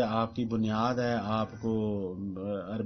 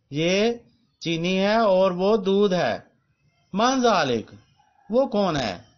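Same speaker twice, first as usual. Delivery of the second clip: slow, raised, emphatic teacher read-aloud; classroom drill reading; 9 semitones higher, 10 dB louder, slower than usual. Acted. Teacher reading the lesson sentences aloud to students, slow and emphatic. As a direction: spell out each sentence for students to repeat.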